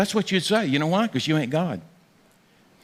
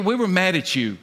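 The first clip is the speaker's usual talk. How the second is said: normal talking voice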